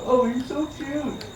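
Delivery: high, slow swooning coo; breathy-sounding swoon, high and slow; cooing fans. Retold or imitated. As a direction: coo it like a smitten fan. Men cooing at stripper.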